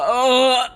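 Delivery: loud, high groan of revulsion; disgusted cry. Real, not acted. Player recoiling at a gruesome scene, disgusted cry. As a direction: cry out as if queasy.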